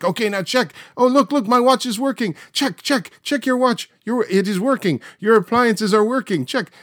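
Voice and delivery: very high, loud triumphant showman voice; Uri Geller on TV. Retold or imitated. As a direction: announce the miracle to the viewers in triumph. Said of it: "Uri's like".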